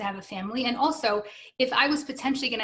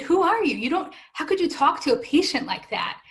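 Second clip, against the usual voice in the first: indignant; 6 semitones higher, 3 dB louder than usual. Retold or imitated. Indignant retort to rude doctor.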